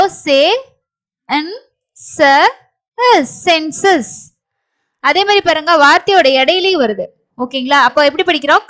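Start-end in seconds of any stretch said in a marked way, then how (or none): 0.1-4.1 slow, isolated sounds, then the word; sounding out. Shown as a demonstration. Teacher demonstrating how to read a word, sounded out. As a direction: spell out each sound with a pause. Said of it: "இதை எப்படி படிப்போம்?".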